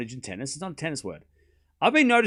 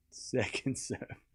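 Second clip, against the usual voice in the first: slow, much softer "Second serve", drawn out like a hushed commentator; 2 semitones lower, 11 dB quieter, much slower than usual. Pretend. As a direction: whisper the call as if not to disturb the players. Quiet slow repeat of call.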